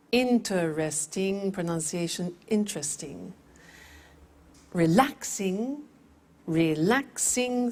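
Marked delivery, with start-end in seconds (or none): 0.1-1.5 Interesting stretched slowly into four beats; slow syllables. Shown as a demonstration. 4.7-7.7 Relaxing said slowly in three beats; slow syllables. Shown as a demonstration.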